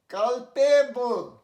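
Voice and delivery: কালপেবল slow and loud; slow careful pronunciation. Shown as a demonstration. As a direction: spell out কালপেবল syllable by syllable. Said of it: "teacher drills word". (ধীরে জোরে কালপেবল)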